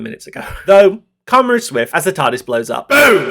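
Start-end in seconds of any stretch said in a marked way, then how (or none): 2.9-3.3 loud boom. Pretend. Voicing the tardis blowing up.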